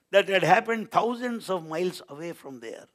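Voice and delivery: usual speaking voice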